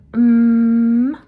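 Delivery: long, loud hummed "mm"; nasal m. Shown as a demonstration. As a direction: hold "mm" through the nose, lips together. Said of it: "air is coming out the nose".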